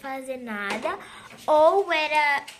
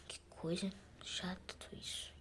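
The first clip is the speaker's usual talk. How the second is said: whispered complaint under the breath; whispered grumble; 7 semitones lower, 15 dB quieter than usual. Acted. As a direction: mutter it so the passenger can't hear. Attendant grumbling aside to herself. (resmungo sussurrado para si mesma)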